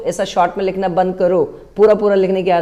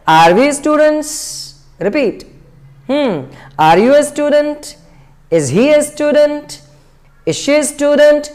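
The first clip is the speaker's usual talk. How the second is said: higher, slow questions, each ending up; rising tone; 5 semitones higher, much slower than usual. Shown as a demonstration. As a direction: lift every question's last word. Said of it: "rising tone में बोलना है". (Rising tone में धीरे-धीरे सवाल)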